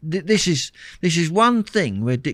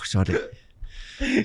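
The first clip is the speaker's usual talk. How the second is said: ordinary talk, nothing marked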